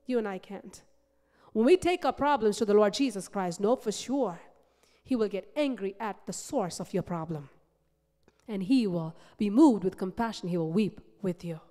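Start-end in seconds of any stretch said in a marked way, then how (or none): none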